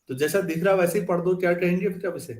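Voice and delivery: ordinary talk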